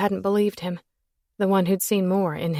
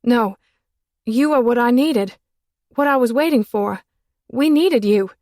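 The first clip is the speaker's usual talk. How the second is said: high, loud desperate pleading; desperate plea; 4 semitones higher, 7 dB louder than usual. Acted. Woman begging her lover, loud and desperate. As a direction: beg him to stay, voice raised.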